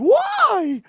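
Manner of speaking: very high, loud, drawn-out cry; mock anguished wail. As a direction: wail it out like a hammed-up victim. Imitates self-stabbed person crying out.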